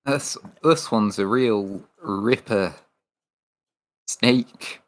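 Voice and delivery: slow, hammed-up Aussie showman; lightly voiced mock Irwin, slow; fake Australian accent. Teasing Steve Irwin's Australian accent, slow and hammed-up. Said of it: "fake Australian accent".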